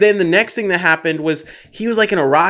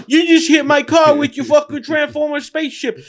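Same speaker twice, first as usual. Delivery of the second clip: mock shout, higher and angry; retold yelling; 7 semitones higher than usual. Angry driver yelling at Mark Wahlberg, mock shout. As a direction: yell like a driver whose car got crushed. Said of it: "the guy's yelling at him".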